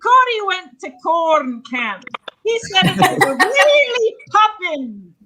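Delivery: high, hammed-up Irish accent; Irish brogue. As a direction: redo it in a lilting Irish brogue. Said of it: "you didn't sound Irish at all".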